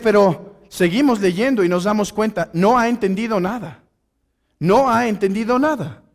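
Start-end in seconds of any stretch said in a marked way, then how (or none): none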